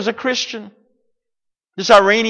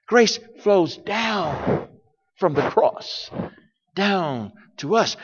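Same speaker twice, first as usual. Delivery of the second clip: soft slow preaching; 8 dB quieter, slower than usual. Acted. Preacher softens.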